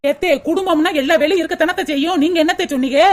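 mocking man. Husband scoffing at the mother's fuss, low sarcastic.

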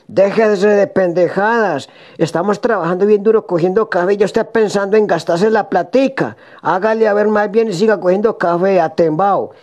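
regaño agudo e insistente; regáñalo subiendo el tono, como esposa harta. Acted. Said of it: "ya todo regañado". (esposa regañando al marido)

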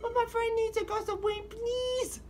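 very high little cat voice. Acted. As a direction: squeak it like a tiny kitten. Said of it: "hear me do my little cat voice".

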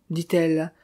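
plain reading voice

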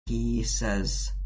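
ordinary talk

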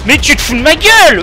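high furious shout; raging gamer. Real, not acted. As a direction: yell it in disbelief. Repeats outraged line.

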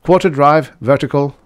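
loud, high command; sharp order. Acted. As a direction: snap out the command. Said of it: "Fisk ordered".

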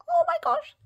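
very high-pitched gush; delighted squeal. Real, not acted. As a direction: squeal at how cute it is. Gushing over little freebie.

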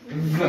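"v" as a buzzing voiced consonant; isolated v sound. Shown as a demonstration. Teacher presents the second sound, "v" said as a voiced buzz. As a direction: sound out "v" by itself, with the voice on. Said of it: "et on a le son".

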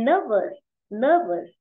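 "nervous" with a strong first syllable, falling away; stress on first syllable. Shown as a demonstration. Teacher models the word for the class, first-syllable stress on "nervous". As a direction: punch the first syllable of "nervous", let the second drop. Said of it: "trọng âm rơi vào âm tiết một".

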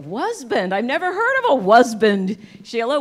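a loud mock-astonished husband; loud incredulous retort. To Sheila about her new word, loud disbelief. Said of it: "I go".

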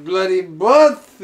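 put-on Scottish accent. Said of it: "Scottish hole".

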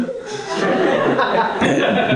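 real laughter; high laugh. Real, not acted. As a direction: laugh high and long at the joke. Preacher laughs at Ricky's joke.

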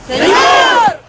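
high shout. Real, not acted. Group shouting back.

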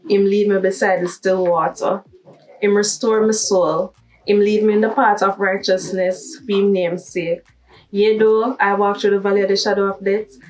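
Bible reading in Jamaican Patois. Shown as a demonstration. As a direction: read a psalm aloud in Jamaican Patois. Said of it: "in a patois too".

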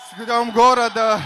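loud shout to the home crowd. Real, not acted. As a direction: call it out to the whole arena. Fighter shouts greeting to crowd.

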